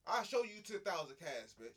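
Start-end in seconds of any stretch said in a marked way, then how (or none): none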